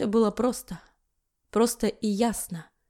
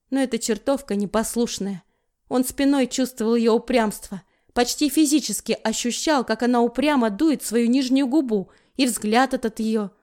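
usual speaking voice

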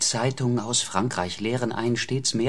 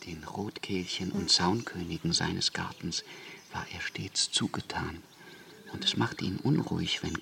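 hushed aside; 2 semitones lower, 6 dB quieter than usual. Acted. Wasianski speaks softly of Kant.

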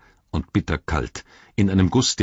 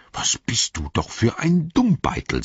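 higher, mock scolding; scheltender Ohm; 7 semitones higher than usual. A narrator's reading. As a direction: quote the old uncle telling you off. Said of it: "so hat der Ohm immer zu mir gesagt". (leicht nachgeahmtes Schelten)

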